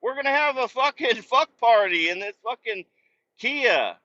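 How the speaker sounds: high, loud, rowdy demand; hammed-up rowdy yell; mock rowdy homeless guy. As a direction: holler to be let into the car. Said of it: "They're like".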